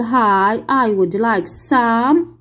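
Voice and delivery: slow, careful passenger reply; very slow model reading. Shown as a demonstration. Teacher playing the passenger, slow model answer. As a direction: lay out every word for repeating. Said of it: "دورك هنا المسافر تقول".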